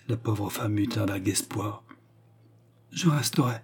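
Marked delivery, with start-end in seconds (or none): 2.9-3.6 meek, understated consent; soumission. A narrator's reading. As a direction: give in submissively. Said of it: "dit-elle avec soumission". (soumission douce et discrète)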